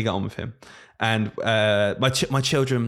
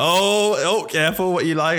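laughing warning; 6 semitones higher, 4 dB louder than usual. Retold or imitated. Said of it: "I'm just going".

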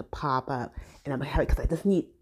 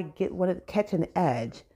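usual speaking voice

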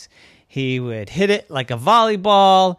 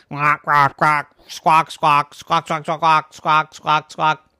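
loud, harsh bird squawks; squawking seagulls; 4 dB louder, slower than usual. Acted. Upset seagulls squawking at the boy, loud bird calls. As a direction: squawk like angry gulls. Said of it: "They start squawking".

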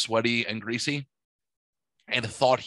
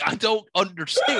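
tag; 7 semitones higher, 3 dB louder than usual. Retold or imitated. Retold sister's bafflement.

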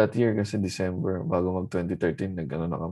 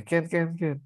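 higher-pitched, repeated Singlish "can"; Singlish "Ken"; 7 semitones higher, slower than usual. Retold or imitated. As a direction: mimic the Singaporean yes. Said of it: "Yung yes nila".

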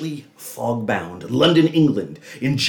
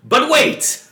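high, loud stop-the-story cry; dramatic interruption; 4 dB louder than usual. Acted. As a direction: slam on the brakes theatrically. Dramatic interruption of own narration.

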